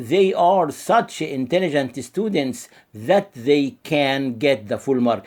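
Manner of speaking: very slow, word by word, for copying; slow dictation. Shown as a demonstration. Teacher to class, dictating the model sentence, very slow and deliberate. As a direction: spell the sentence out slowly so students can write.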